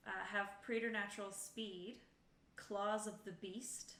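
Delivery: ordinary talk, nothing marked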